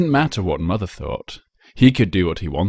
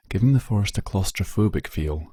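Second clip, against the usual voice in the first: ordinary delivery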